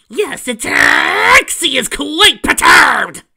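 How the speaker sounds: booming, high and slow, full of wounded pride; indignant Trixie. Acted. Trixie announcing her outrage in a slow high shout. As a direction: proclaim it like an offended showmare. Trixie voiced in fanfic reading.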